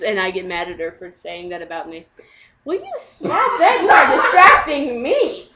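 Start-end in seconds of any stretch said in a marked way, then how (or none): none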